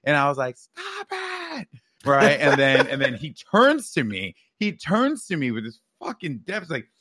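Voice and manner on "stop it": high, strained mock shout; squealed, held-back pretend scream; retold yell. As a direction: imitate a kid yelling over music, without full volume. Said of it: "yelling at him 'cause it's so loud".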